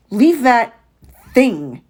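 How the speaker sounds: slow, commanding man's voice; demanding. A narrator's reading. Stranger orders the boy to leave the dog outside, slow and stern. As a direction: order it, dragging the words. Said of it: "he demanded".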